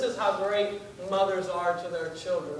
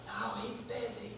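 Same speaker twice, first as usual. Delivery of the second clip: higher, very soft secretive voice; child's whisper; 4 semitones higher, 10 dB quieter than usual. Acted. Kid puts off the caller about dad, whispering.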